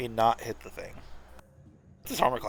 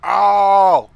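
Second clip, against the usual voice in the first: pained yelp; 6 semitones higher, 11 dB louder than usual. Acted. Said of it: "the mimic who's just like".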